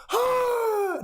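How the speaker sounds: sharp high gasp of shock. Retold or imitated. Mocking the wife who hears her husband's truth, sharp high gasp. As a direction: gasp like she just heard the truth.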